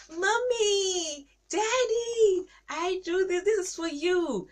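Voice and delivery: put-on very high, eager little child. Child handing parents a drawing, high and delighted. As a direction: squeal it like a proud toddler.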